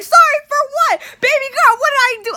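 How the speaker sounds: very high, loud protest; shrill-pitched, hammed-up indignation; indignant outburst. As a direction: shriek back in disbelief. Reenacting indignant reply to mom.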